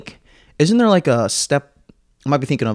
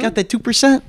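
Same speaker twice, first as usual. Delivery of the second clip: higher put-on asking voice; imitated suffering man; 9 semitones higher than usual. Man sweating from hot wings asks staff, imitated high plea. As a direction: play the man pleading after hot wings. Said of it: "just like".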